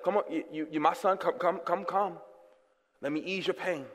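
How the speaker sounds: soft, low, tender calling; tender God. Retold or imitated. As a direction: softly coax a hurting son. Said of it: "but God gives me mercy. Like".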